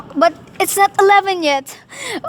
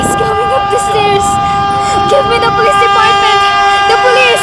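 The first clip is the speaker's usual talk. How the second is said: panicked shouting; 2 semitones higher, 5 dB louder than usual. Acted. Woman screaming to the operator for police, panicked shouting. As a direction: yell for the police in sheer panic. Begging operator as killer approaches.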